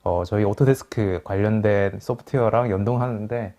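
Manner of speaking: normal talking voice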